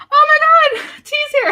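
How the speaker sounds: high, loud delighted squeal; excited greeting. Real, not acted. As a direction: squeal as a friend turns up. Spots a friend in live chat.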